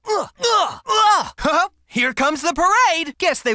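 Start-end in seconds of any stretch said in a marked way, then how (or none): 0.4-1.3 effort grunt. Acted. Battle exertion grunts.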